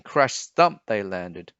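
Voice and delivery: ordinary delivery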